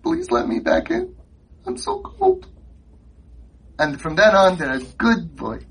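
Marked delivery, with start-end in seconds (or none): 0.0-2.5 much higher, pleading little voice; shivering boy begging at the door; mock pitiful child; begging cold kid. Locked-out kid begging to return.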